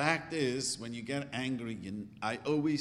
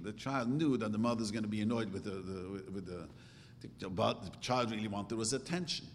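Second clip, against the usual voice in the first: ordinary talk, nothing marked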